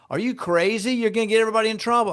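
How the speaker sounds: high, fast mock outburst at the sellers; mock losing it. Agent pretending to blow up at lying sellers, mock angry. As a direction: fire it off like you've lost your temper. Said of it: "I'm not losing it".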